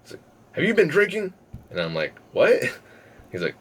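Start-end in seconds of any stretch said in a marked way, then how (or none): 0.5-1.3 much higher, suspicious questioning; quizzing bouncer. Retold or imitated. Bouncer questioning a drunk patron, high and suspicious. 2.3-2.6 much higher and louder, baffled; startled what. Retold or imitated. Caught off guard by the bouncer, high loud what.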